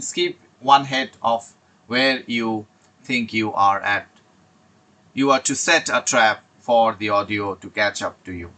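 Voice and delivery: slow, deliberate reading of a study tip; slow slide reading. Acted. Teacher reads the tip to IELTS students, slowly. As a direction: read it out word by word like a slide.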